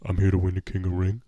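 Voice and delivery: imitating Shaq with a mild change of voice; Shaq impression. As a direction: do Shaq's line, lightly voiced. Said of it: "This is Shaq".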